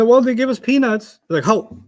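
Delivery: ordinary delivery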